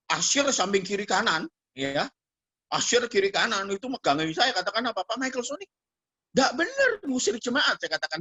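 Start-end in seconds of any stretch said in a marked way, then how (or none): 5.1-7.8 high-pitched retold objection; indignant protest. Bystander objecting to the pastor evicting someone, indignant protest. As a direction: scold the pastor, lightly imitated.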